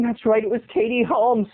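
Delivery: ordinary talk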